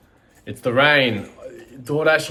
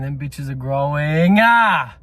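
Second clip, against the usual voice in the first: mock shock; 3 semitones higher, 4 dB louder, slower than usual. Real, not acted. Joking about eye bags.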